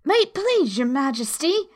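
humble, careful appeal. A narrator's reading. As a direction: address royalty meekly. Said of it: "in a very humble tone".

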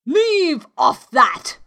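loud, high, drawn-out royal yell; slow, piercing command; screamed order. A narrator's reading. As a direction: scream it like an imperious queen. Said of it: "screamed the queen".

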